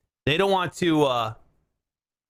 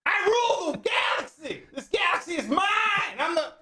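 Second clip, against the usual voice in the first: high, strained tyrant shout; manic, grandiose yelling; 12 semitones higher than usual. Acted. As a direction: proclaim it like a crazed alien overlord. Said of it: "sounding like [name] on, like, steroids".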